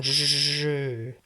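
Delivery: long buzzing "je"; "je" drawn right out to demonstrate the sound; isolated sound. Shown as a demonstration. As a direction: sustain the "j" in "je". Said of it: "on entend le son je".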